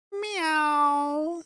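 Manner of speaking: high pitched cat meow; half-hearted mock cat meow. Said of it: "That's not even close".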